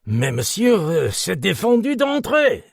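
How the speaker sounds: loud, high French protest; French policeman. A narrator's reading. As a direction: refuse him in firm French. French policeman refusing entry.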